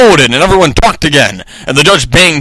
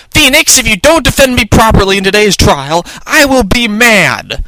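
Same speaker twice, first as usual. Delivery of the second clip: pouty Maya; 8 semitones higher than usual. A narrator's reading. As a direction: pout and threaten in a high little voice. Voicing Maya threatening her lawyer.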